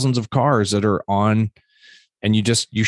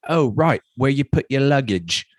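English friend; 7 semitones higher than usual. Retold or imitated. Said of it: "He's like".

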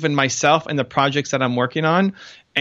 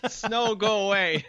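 laughing; 7 semitones higher, 6 dB quieter, slower than usual. Pretend. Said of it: "billboard joke, laughing".

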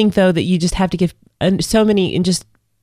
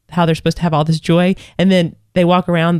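usual speaking voice

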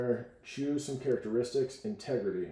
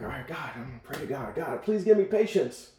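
imitating an imploring prayer; pleading prayer; 3 semitones higher, 5 dB louder than usual. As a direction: beg heaven earnestly, getting louder. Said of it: "uh, praying".

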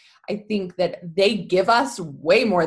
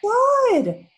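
Oh my God, much higher and louder in real shock; shocked gasp; 7 dB louder than usual. Real, not acted. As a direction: blurt it out, horrified. Reacting to gun video story.

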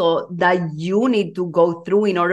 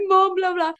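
much higher-pitched, imitated tearful voice; mock crying; 12 semitones higher than usual. Coach retelling her emotional call to her mom, mock crying.

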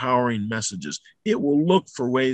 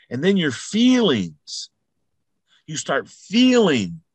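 louder, higher, slower with growing fervour; rising excitement; 4 semitones higher, 4 dB louder, slower than usual. Real, not acted. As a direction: build it up like a preacher. Motivational build.

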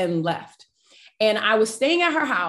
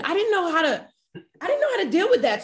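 laughing through it, much higher and fast; 7 semitones higher, much faster than usual. Real, not acted. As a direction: laugh at yourself as the words tumble out. Laughing at her own past.

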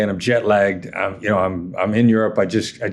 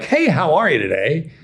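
higher-pitched, swooping friendly hello; mock chipper greeting; 5 semitones higher than usual. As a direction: put on an instantly cheery tone. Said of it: "I can't immediately spring into ... tone of voice".

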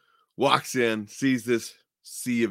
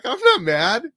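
laughing reveal; 10 semitones higher, 5 dB louder, much slower than usual. Retold or imitated. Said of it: "he started laughing".

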